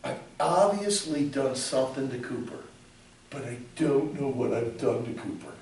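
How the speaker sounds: low, soft mock prayer and apology; a quiet imitation of praying. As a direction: murmur it as a worried prayer. Voicing prayer and weak apology.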